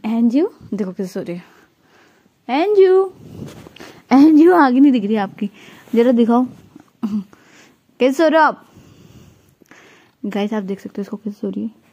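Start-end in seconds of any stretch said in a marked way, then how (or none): 2.5-3.0 high, loud call of the name; sing-song call to a pet. Real, not acted. 4.1-8.7 loud pet talk. Real, not acted. Talking loudly to the pet.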